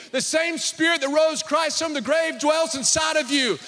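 loud, high preaching voice; full-force preaching. Acted. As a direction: preach it with fire to the congregation.

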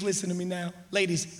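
usual speaking voice

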